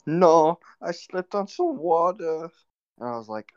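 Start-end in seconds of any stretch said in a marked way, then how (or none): 0.1-2.5 high, drawn-out put-on accent voice; imitated high-pitched foreign woman; injured woman's voice. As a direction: mimic the hurt woman, high and slow. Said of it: "and she goes".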